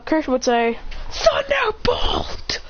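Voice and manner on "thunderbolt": very high, drawn-out battle cry; attack call. Acted. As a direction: stretch the attack name way up high. Hero calls out his attack.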